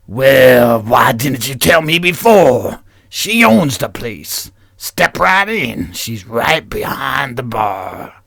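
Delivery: slow, drawn-out biker voice. Acted. Biker welcoming newcomers in, slow drawl. Second take of biker reply.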